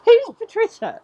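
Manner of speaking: high, loud question amid laughter; giggly outburst. Real, not acted. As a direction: squeal the question while cracking up. Laughing fit over unknown Patricia.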